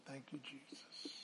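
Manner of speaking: breathy whispered thanks; whispered prayer. Real, not acted.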